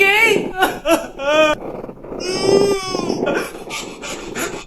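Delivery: hysterical laugh-cry shriek. Acted. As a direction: screech with laughter and tears. Wild laughing-crying outburst.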